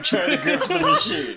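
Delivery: breaks into high laughter mid-sentence; laughing admission. Real, not acted. Player laughing as she admits grifting the game. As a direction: laugh it out, caught red-handed.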